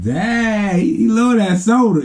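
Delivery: high, drawn-out disbelief; mock amazement. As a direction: marvel high, stretching the first word. Retold onlookers' amazed reaction.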